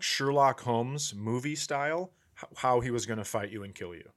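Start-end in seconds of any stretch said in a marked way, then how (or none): none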